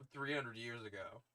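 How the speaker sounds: ordinary delivery, nothing marked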